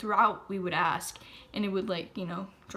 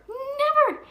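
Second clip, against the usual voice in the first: "Never." shouted much higher and louder; shrieked warning; 9 dB louder than usual. Real, not acted. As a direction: scream "Never." up high, then fall. Escalated warning.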